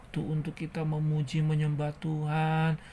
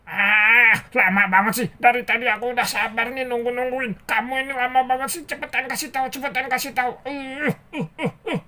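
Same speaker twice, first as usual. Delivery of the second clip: loud high cartoon tantrum; hammed-up fed-up rant; angry impatient character; 9 semitones higher, 8 dB louder, faster than usual. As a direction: complain at speed, climbing into wordless fussing. Angry character loses patience.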